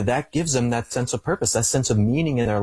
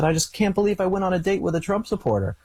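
high, put-on voice of the tearful date; mimicking the crying date; 9 semitones higher than usual. Retelling his Tinder date's tearful line in a high mock voice. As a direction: mimic her wailing disbelief, lightly. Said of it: "She said".

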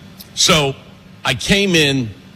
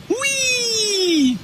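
mocking squeal. Mocking Mayor Pete as a fake.

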